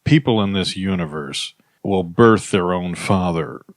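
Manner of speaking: ordinary talk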